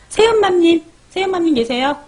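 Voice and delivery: high, loud call of a viewer's name. Real, not acted. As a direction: call her name out across the room. (큰 소리로 이름 부르기)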